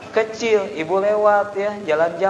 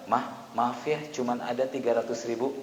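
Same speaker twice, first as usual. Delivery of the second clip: low, very soft apology; apologetic husband; 6 semitones lower, 10 dB quieter than usual. Acted. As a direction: confess it meekly to your wife. Husband apologises to his wife.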